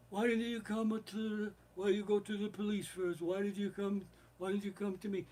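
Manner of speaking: imitated crime boss, high and held-back; Godfather. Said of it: "Godfather's like".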